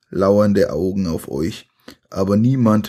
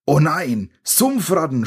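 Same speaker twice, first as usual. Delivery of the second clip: high alarmed cry; scream; 7 semitones higher than usual. A narrator's reading. Sandy spotting swamp rats, high alarmed scream. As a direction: shriek it out at the rats, pitched up. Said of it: "schreit Sandy".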